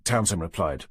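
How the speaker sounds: ordinary delivery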